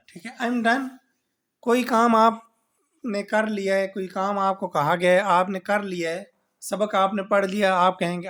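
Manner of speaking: ordinary delivery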